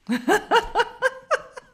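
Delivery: high, loud burst of laughter; hearty laugh. Real, not acted. Interviewee amused by her "beaucoup moins carré", hearty laugh. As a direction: laugh out loud in a long peal.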